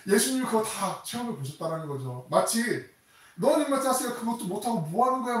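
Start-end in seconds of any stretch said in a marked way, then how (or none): 3.3-5.4 high, loud harsh scolding. Acted. A scolding God yelling at someone who gave up, loud and angry. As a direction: bark at a weakling who failed. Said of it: "막 이렇게 야단치는 게 아니라".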